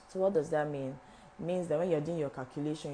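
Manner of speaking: normal talking voice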